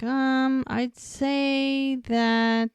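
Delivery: imitating a careful, halting reply; hesitant answer. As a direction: stretch out the words, feeling for an answer. Retold hesitant answer in TV scene.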